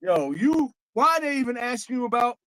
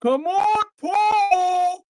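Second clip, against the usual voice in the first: higher, loud Harlem hype voice calling Paul; [name] impression; 7 semitones higher, 4 dB louder, much slower than usual. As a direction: shout at Paul like [name] would. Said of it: "I'm gonna put my [name] voice on".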